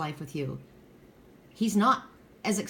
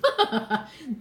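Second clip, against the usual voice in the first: short burst of open laughter; amused laugh; 5 semitones higher than usual. Real, not acted. Tarot reader joking about girlfriend getaways, amused laugh. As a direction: laugh at your own remark. Laughs at her own advice.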